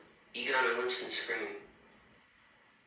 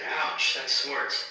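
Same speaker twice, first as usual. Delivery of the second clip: 1 ouch yelp; 2 semitones higher, 5 dB louder, much slower than usual. A narrator's reading. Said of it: "he said".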